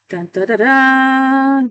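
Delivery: slow, high hummed trumpet fanfare; trumpet call. Retold or imitated. Reader voicing the trumpet calling someone home, slow high hum. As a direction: voice a heavenly trumpet call, drawn out slowly. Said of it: "That's the sounding the trumpet".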